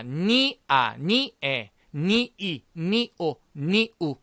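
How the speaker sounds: "니, 아" and each following pair broken into two separate sounds; split-sound demonstration. Shown as a demonstration. Teacher showing students how ñ combines, split-sound demonstration. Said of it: "이렇게 발음이 나니까".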